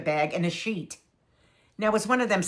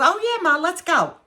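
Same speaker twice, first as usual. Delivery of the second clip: high, louder mock-eager "oh yeah"; eager daughter; 10 semitones higher, 4 dB louder than usual. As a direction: play the daughter jumping at the idea. Daughter's voice in retold story.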